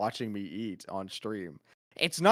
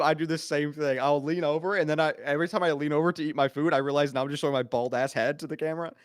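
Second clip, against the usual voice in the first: ordinary talk, nothing marked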